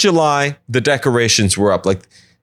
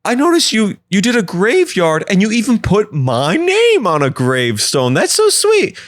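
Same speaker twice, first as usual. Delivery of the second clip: high, sweet husband voice, much higher; doting husband; 10 semitones higher than usual. Acted. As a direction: gush like an oblivious husband admiring the yard. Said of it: "I was like".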